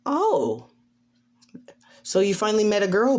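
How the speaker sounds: high-pitched teasing mother; high, delighted ribbing; Inko. Acted. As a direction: pitch jumps on the first word, then rush on. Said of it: "As Inko is like".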